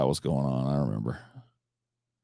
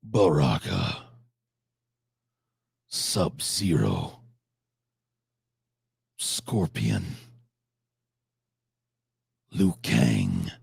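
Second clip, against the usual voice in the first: slow, low fighting-game announcer call; imitating the game announcer; 9 semitones lower, much slower than usual. Streamer echoes the game announcer's roster, low and slow. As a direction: drag each fighter's name out like the game does.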